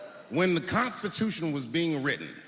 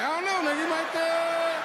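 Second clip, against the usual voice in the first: mock suggestion; 12 semitones higher, 4 dB louder than usual. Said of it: "I even suggested to him that he should try it out".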